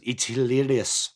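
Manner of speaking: lightly put-on South African accent; a hint of South African, deadpan; a touch of a South African accent, dry and amused. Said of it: "South African accent".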